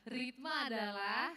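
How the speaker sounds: slow, stretched out with a rising end, coaxing an answer; drawn-out prompt. Shown as a demonstration. Teacher coaxing students to finish the sentence, drawn out. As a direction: stretch adalah so they fill in the rest. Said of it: "ayo coba".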